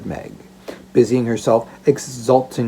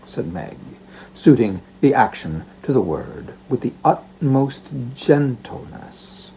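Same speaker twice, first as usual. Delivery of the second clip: ordinary delivery, nothing marked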